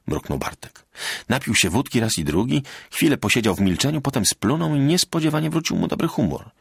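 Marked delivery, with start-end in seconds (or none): none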